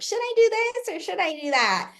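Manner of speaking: high, mocking whiny question; mocking dumb askers. Mocking everyone else asking stupid questions, whiny high voice. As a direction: mimic a clueless person asking for permission. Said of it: "It's like".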